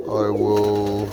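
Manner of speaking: ordinary delivery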